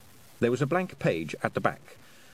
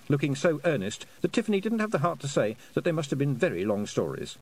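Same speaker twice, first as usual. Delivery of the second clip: ordinary delivery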